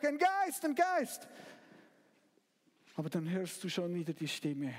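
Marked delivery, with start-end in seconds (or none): 0.0-1.3 high, loud panicked shout of ghost; frightened cry. Acted. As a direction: cry out in terror at the shape. Disciples see a ghost. (panischer, hoher Ausruf)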